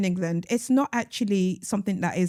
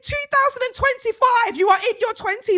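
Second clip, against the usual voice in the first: very high, loud incredulous plea; high-pitched, drawn-out outburst; exasperated shout; 14 semitones higher, 3 dB louder than usual. Real, not acted. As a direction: cry out in disbelief, stretching the words. Appeals to the letter writer, exasperated.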